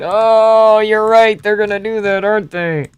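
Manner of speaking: 1 mock surprise. Real, not acted.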